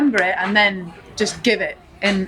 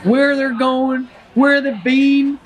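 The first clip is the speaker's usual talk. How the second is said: higher, slow Southern Forrest voice; slow, earnest Forrest Gump; Forrest Gump accent; 5 semitones higher, slower than usual. Acted. Forrest musing about shoes, slow Southern accent. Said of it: "Do the accent".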